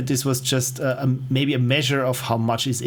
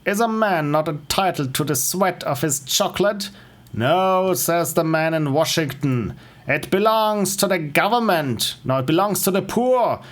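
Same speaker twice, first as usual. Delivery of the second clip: higher-pitched, grandiose parody monologue; declaiming orator; 4 semitones higher than usual. Acted. Streamer parodying Andrew Ryan's speech, declaiming. Recites a game character's speech.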